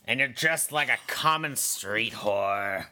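put-on low, sour old woman; old hag voice. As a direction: sneer like a bitter old lady. Gladys insult.

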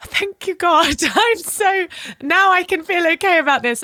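high, laughing burst of relief; relieved joy. Retold or imitated. Mum retelling to the host how relieved she was, laughing high. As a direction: gush thanks like a weight just lifted. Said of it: "and I was like".

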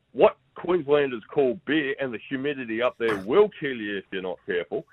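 ordinary talk

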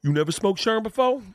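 high-pitched incredulous question; put-on high voice, rising at the end; celebrity impression. Comedian imitating celebrity asking about drugs, incredulous. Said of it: "And he's like".

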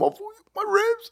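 high, strained moan about ribs; pained cry. Retold or imitated. Telling his friend about the rib injury, high hurt moan. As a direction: wince it out, clutching hurt ribs. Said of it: "And I'm".

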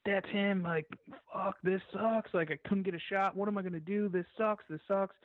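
high, whiny frustrated groan at the missed shot; exasperated, near-whining complaint; upset and frustrated. Retold or imitated. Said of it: "And now I'm all upset".

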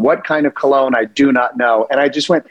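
ordinary delivery

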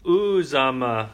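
slow, drawn-out Uzama, stress on the ooze; slow repeat of Uzama. Shown as a demonstration. Host repeating the player's name slowly to get Uzama right. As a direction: repeat Uzama slowly, leaning on the first syllable. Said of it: "I don't think it's Uzama".

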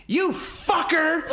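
high-pitched, drawn-out mock scream; retold scream. Co-host retelling Melanie screaming at him, high mock scream. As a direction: imitate her screaming the insult. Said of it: "starts screaming at me".

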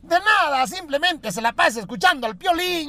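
doctor's punchline. Acted.

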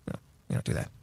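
conspiratorial whisper; hushed aside. Acted. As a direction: breathe it like a secret between allies.

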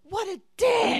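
high, loud outraged exclamation; indignant shriek. Real, not acted. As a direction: cry out in disbelief. Reacting to rude guy.

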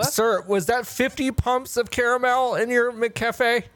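stunned worker recoiling; appalled. Acted. Worker disgusted at order.

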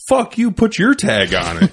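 high, loud, slow furious retort; dad's angry retort. Retold or imitated. Dad mocking the guy who shot the deer. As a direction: throw the words back at him. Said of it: "My dad's like".

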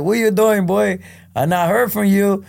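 high, warm Puerto Rican grandpa imitation; Puerto Rican accent. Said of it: "heavy Puerto Rican accent".

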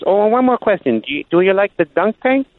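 high put-on caller voice, rising at the end; sly odd-caller question as he hangs up; prank caller voice. Caller asks the sportscaster about the dunk tank, high mock voice. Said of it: "he, he says".

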